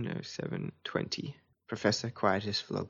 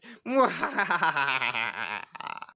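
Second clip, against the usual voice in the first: long gloating villain laugh; evil laugh; 4 semitones higher than usual. Acted. Villain laughs at his plan.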